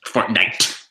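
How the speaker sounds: mock-irritated "Fortnite"; imitating pissed delivery. Friends mimicking how the game's name was said, mock pissed. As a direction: echo the word like someone fed up with it. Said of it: "The way you say Fortnite".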